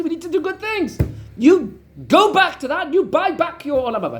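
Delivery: high, pleading wife voice, slowing to stretch the big words; a wife preaching eternity at her husband; insistent wife. Retold or imitated. Wife scolds husband to buy back his afterlife, high and pleading.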